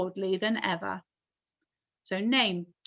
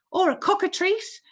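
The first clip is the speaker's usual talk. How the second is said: high loud yell; police chief yelling; 10 semitones higher, 5 dB louder than usual. A narrator's reading. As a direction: yell in alarm. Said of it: "yelled the chief of police".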